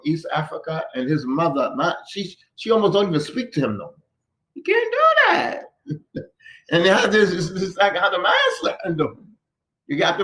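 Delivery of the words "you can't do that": high, drawn-out protesting cry; mock outraged objection. Mother objecting to her son's marriage, mock outraged.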